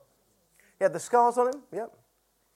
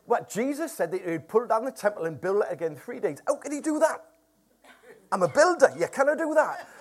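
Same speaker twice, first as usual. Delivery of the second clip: put-on Morpeth builder's accent. Preacher mimicking builder puzzled by temple rebuilding, Morpeth accent. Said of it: "keep doing this accent".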